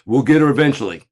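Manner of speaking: high, loud stammering excuse; flustered agent. Retold or imitated. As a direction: stumble out a weak excuse. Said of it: "you go".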